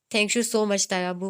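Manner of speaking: ordinary delivery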